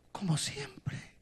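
soft unvoiced whisper. Old Hosea hoping for his wife's return, whispered. Old man's tender hope.

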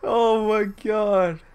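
loud helpless laughter; laughing hard. Real, not acted. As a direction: crack up until it squeaks. Cracking up at angry opponent.

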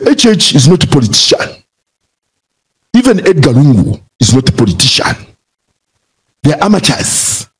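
mock Nigerian accent, imperfectly done; Andrew Ajimadu, Nigerian accent. Broadcaster quoting his rival's dismissal of politicians, mock Nigerian accent. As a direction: imitate the opponent in a put-on Nigerian accent. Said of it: "how to do a Nigerian accent".

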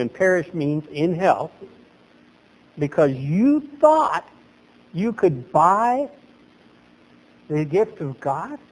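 ordinary delivery

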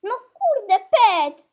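loud, high exasperated cry; frustrated shout. Real, not acted. Player runs into Pet, frustrated shout. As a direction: burst out loudly in annoyance.

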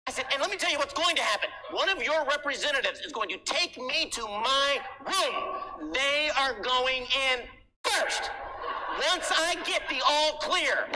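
indignant mock rant. Demanding snipers from hotel staff.

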